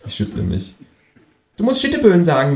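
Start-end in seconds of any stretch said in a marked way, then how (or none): 1.6-2.6 high, fast teasing kangaroo; quick high tease. Acted. Kangaroo teases narrator about wordplay, high fast.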